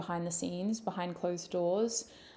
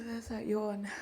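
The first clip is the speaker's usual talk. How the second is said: usual speaking voice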